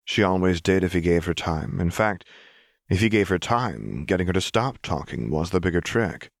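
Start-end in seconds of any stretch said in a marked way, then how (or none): none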